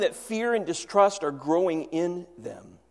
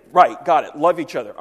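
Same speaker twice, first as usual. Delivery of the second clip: offhand dismissive; 10 dB louder than usual. Retold or imitated. Peter brushing off Jesus' command.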